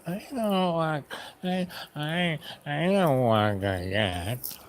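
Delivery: exaggerated whine, dragging out the words; whining. Shown as a demonstration. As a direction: imitate a sulky whiner, slow and drawn out. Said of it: "Do I really whine?".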